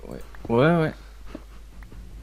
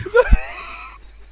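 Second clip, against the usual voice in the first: burst of laughter. Real, not acted. Laughs at his own crude joke.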